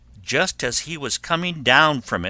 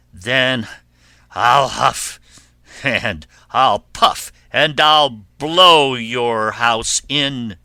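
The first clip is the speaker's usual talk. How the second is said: loud, slow, forceful threat; wolf's threat; 7 dB louder, slower than usual. Acted. Wolf threatens pig in brick house, loud menace. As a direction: punch out each threat with long words.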